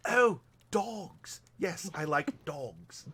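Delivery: lightly put-on mumbled pikey accent; a slow, lazy mock traveller drawl; Snatch pikey accent. Answering the Snatch riff in a lightly put-on pikey accent. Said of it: "the pikeys from Snatch".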